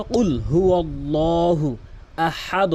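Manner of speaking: slow chanted recitation; Quran recitation. Shown as a demonstration. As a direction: recite it slowly, tajweed style. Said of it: "এরপরে তাহলে কি হলো".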